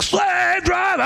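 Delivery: high, drawn-out full scream. Retold or imitated. Jail singer told to scream it into the phone, screamed. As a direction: scream it long like a hardcore frontman. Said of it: "Scream it".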